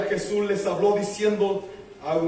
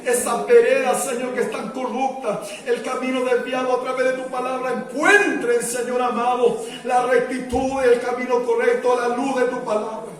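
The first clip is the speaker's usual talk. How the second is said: insistent, driving pulpit petition; fervent public prayer; 5 semitones higher, 5 dB louder than usual. Real, not acted. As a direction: press each plea on towards God. Prayer grows more forceful. (oración ferviente e insistente)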